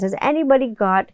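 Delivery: ordinary talk, nothing marked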